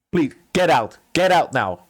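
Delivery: acted angry command; angry dismissal. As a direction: throw them out on the spot. Steward throws the elf out.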